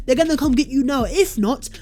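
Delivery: usual speaking voice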